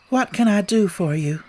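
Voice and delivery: ordinary delivery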